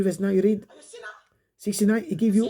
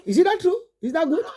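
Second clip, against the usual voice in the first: rhetorical challenge; 6 semitones higher than usual. Real, not acted.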